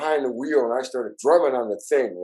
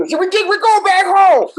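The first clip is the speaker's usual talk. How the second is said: high falsetto whine, loud and laughing; mock the panicked bandmates in a loud squeaky falsetto; mock scared bandmate; 9 dB louder than usual.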